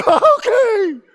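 high, loud laughing Okay; laughing nervous okay. Retold or imitated. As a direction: agree nervously through a laugh. Nervous agreement while laughing.

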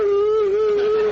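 loud, high hooting ooh; celebratory whoop. Retold or imitated. Ray running to the dugout, loud whoop. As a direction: whoop like a giddy player.

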